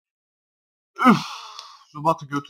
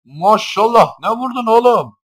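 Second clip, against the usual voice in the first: amazed; 10 semitones higher, 13 dB louder than usual. Real, not acted. Amazed at a strong hit.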